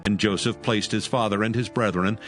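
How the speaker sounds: normal talking voice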